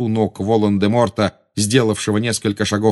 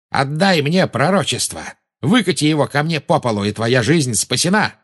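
raised, demanding call; Belatrisa calling out; 6 semitones higher than usual. A narrator's reading. Witch demands the prophecy from the boy, calling out. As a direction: demand it in a high voice. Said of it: "крикнула Белатриса".

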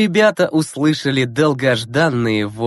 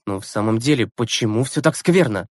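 plain reading voice

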